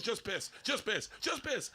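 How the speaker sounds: high, strained self-coaxing in mock panic; urging himself. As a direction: plead with your own body, high and drawn out. Acts out panicked inner voice.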